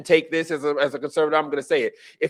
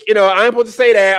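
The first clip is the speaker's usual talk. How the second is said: ordinary talk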